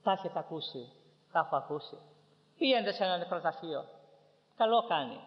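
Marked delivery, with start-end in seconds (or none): 2.6-5.2 high, ringing sermon voice; impassioned preaching. Real, not acted. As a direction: cry it out to the congregation, stretching the final question. Preaches at full force.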